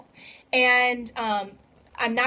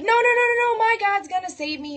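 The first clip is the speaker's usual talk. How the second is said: much higher and louder no-no-no; frantic refusal; 10 semitones higher, 5 dB louder than usual. Retold or imitated. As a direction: yelp the refusal, then settle into my God's gonna save me. Man refuses the ship, escalating.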